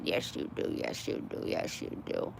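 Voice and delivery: hushed, breathy cooing to a pet; soft whispered coo. Real, not acted. Whispering to a rescued cat.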